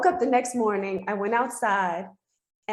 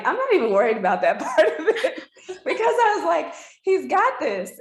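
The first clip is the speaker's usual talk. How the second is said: laughing it off; 7 semitones higher, 4 dB louder than usual. Retold or imitated. Laughs off second surgery news.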